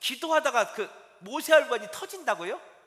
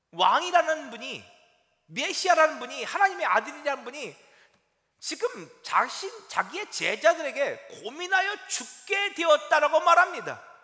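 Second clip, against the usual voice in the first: rising louder through each phrase; preacher building to a louder peak; building sermon emphasis; 6 semitones higher, 6 dB louder than usual. Acted. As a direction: press each title harder, building.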